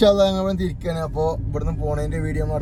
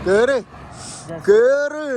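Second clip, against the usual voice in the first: calling out; 7 semitones higher, 4 dB louder, much slower than usual. Real, not acted. Calling someone to get in.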